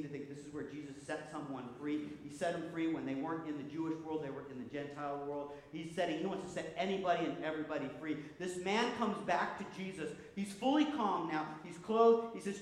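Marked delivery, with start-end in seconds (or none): none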